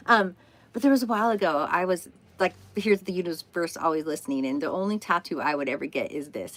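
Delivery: ordinary talk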